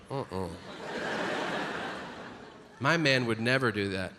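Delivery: low, soft imitation of a doubting woman; comic mimicking women in the crowd, lightly voiced; mock doubting woman. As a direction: mimic a sure wife, softly.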